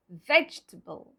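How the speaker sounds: VEGETABLE in three syllables, vej-tuh-bul, low; silent E. Shown as a demonstration. Teacher models the correct way, VEGETABLE as vej-tuh-bul. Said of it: "the pronunciation will be".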